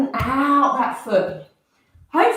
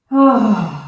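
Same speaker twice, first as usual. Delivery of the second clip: loud breathy oh on the exhale; audible breath sigh; 7 dB louder than usual. Shown as a demonstration. Teacher demonstrating an audible exhale during the swing, loud sigh. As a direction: sound the breath out on an oh. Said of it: "Find a breath".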